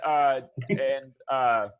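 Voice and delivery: ordinary talk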